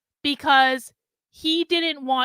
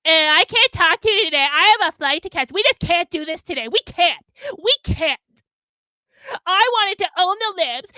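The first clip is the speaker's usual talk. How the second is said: mock whiny excuse; 4 semitones higher, 3 dB louder than usual. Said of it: "and then say".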